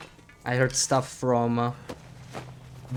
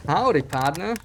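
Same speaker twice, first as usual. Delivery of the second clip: cowboy howdy; 4 semitones higher than usual. Shown as a demonstration. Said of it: "That's my best approximation of the".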